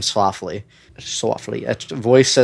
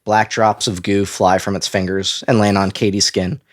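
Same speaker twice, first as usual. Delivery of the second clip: ordinary talk, nothing marked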